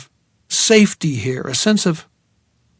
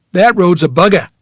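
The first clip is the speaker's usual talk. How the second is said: put-on thick Maine accent; 3 semitones higher, 6 dB louder than usual. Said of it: "such a thick Maine accent".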